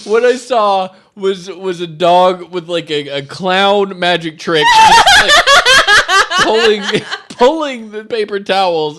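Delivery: high, loud, laughing through words; telling it while cracking up. Real, not acted.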